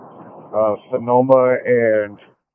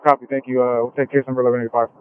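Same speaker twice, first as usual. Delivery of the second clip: police radio; 3 semitones lower, faster than usual. Real, not acted. Officer radio reply to dispatch.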